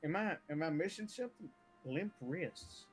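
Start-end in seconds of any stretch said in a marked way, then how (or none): none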